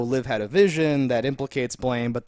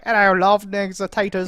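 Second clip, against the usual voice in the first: bad Cockney accent; 6 semitones higher, 5 dB louder than usual. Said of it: "he does Cockney, like, horrible".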